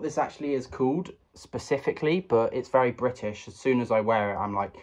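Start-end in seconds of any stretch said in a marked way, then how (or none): none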